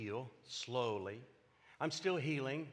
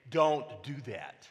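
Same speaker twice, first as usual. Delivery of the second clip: firm warning; 5 dB louder, slower than usual. Real, not acted. Warning against morphine mistake.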